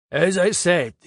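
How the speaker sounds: put-on a pompous French speaker, heavy French accent. A narrator's reading. Frenchman addressing a conference hall, heavy French accent. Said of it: "In his French accent".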